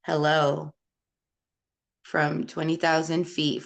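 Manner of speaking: ordinary talk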